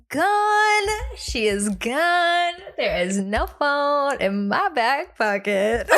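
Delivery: slow, drawn-out mock-tragic announcement; hammed-up slow disbelief; dramatic reveal. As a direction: stretch each word out for comic drama. Dramatic reveal of lost phone.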